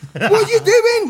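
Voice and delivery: high, loud incredulous squeal; hammed-up shocked question; alarmed. Asking his crying mate on the dance floor, alarmed.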